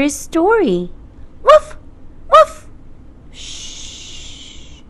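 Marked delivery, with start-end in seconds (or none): 1.4-2.7 high squeaky puppy woofs; puppy bark. Acted. Puppy barks. 3.3-4.8 soft breathy shush. Acted. Hushing the puppy.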